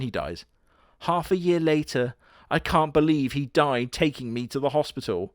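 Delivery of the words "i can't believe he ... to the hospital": usual speaking voice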